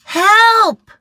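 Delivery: high, very loud cry for help; shrill panicked shout. Acted. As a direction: scream for help. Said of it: "shouted Eddie".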